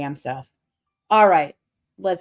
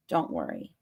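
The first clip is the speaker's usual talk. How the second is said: a much lower, softer don't worry; playful deep-voiced reassurance; 9 dB quieter than usual. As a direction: drop it way down low and soft. Repeating reassurance to viewers.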